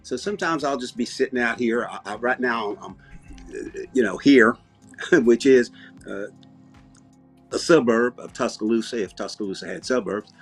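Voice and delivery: put-on folksy Southern accent with easy hesitations. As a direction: talk like a laid-back Southerner. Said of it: "I just started talking like this".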